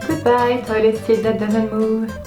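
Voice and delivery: ordinary talk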